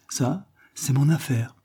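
slow, high, affected and coy; simpering coquettish reply. A narrator's reading. Coquette answering the lawyer's advice, simpering slowly. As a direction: drawl it out like a flirt. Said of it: "répliqua en minaudant la terrible coquette".

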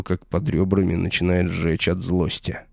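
ordinary delivery

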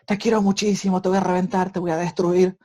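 high, loud, harsh yell of loving words; father shouting loving words at a crying baby in a harsh tone. Retold or imitated. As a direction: bark tender words as if they were threats. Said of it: "con palabras fuertes".

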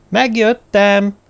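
slow, high, loud call; drawn-out call. A narrator's reading. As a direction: stretch the words as you call to the tent. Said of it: "kiáltotta a sátor felé".

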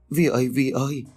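a touch of sobbing while calling Vi. A narrator's reading. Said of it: "bà Thoa chợt sụt sùi khóc".

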